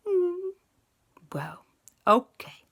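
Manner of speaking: mild pouty hesitation; reluctant agreement. A narrator's reading. Aunt hands the ring over, mildly reluctant. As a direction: give in grudgingly, starting on a high hum. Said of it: "Aunt Lisa pulled this face".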